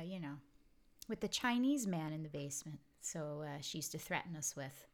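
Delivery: ordinary delivery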